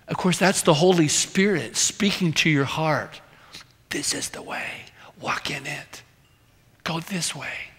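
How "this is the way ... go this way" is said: soft unvoiced whisper from behind. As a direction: breathe it like a voice at your shoulder. Holy Spirit speaking to heart.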